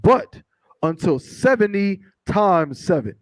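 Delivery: slow, measured scripture reading. Acted. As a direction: read the verse out slowly, weighting each phrase. Reads scripture aloud to congregation.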